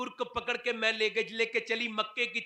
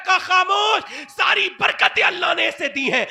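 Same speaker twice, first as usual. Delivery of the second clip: fiery preaching; 5 semitones higher, 9 dB louder than usual. Acted. Preaching at full force.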